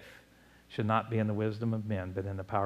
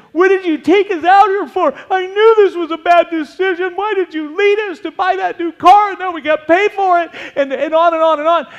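high, loud whining mob voice; a loud mocking whine of a griping crowd; mock crowd complaining; 14 dB louder than usual. Preacher mimicking the complaining Israelites with a loud whine.

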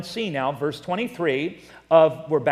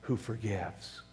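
soft, lowered, reverent preaching voice; hushed testimony; 5 semitones lower, 6 dB quieter than usual. Real, not acted. Preacher thanking God for his forgiveness, hushed and reverent. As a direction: drop low and quiet in gratitude.

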